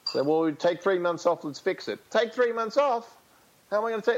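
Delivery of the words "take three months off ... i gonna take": incredulous player. Retold or imitated. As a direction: balk at the idea in disbelief. Player balks at idea.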